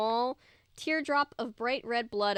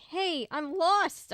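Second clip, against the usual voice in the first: higher, louder, slow shout; 7 semitones higher, 4 dB louder, much slower than usual. A narrator's reading. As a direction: drag out the call for help. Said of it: "I shouted".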